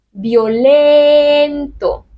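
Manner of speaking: Violento estirado muy despacio y un poco más fuerte; slow syllable demo. Shown as a demonstration. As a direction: spell out Violento slowly and firmly. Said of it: "No tiene ninguna fuerza esa I". (Violento muy lento y marcado)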